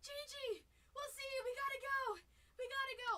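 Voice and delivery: retold frantic goodbye; hurried excited call. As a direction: call out in a panicked hurry. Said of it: "We're like".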